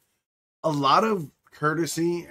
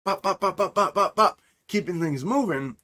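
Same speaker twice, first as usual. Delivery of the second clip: higher, projected, energetic stream delivery; 5 semitones higher than usual. Shown as a demonstration. Showing his cohost his energetic on-stream voice. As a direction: pop it out like you're live on stream. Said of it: "I have my stream voice where I'm projecting and there's energy".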